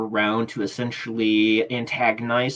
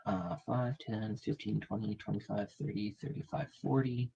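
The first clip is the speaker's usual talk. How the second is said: counting under breath; 14 dB quieter than usual. Real, not acted. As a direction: count quietly to yourself. Game master counting squares to himself.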